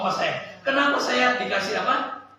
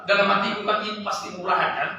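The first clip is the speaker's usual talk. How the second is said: normal talking voice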